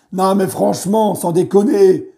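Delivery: ordinary talk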